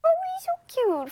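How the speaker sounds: very high, adoring coo; high-pitched melting "aww" voice. Real, not acted. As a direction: gush over an adorable puppy. Anchor coos over a puppy.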